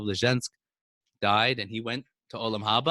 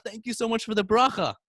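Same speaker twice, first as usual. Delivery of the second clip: higher, eager thank-you; grateful thanks; 10 semitones higher than usual. Retold or imitated. Grateful visitor thanking the Rebbe, high and eager. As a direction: gush your thanks upward.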